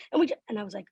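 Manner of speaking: normal talking voice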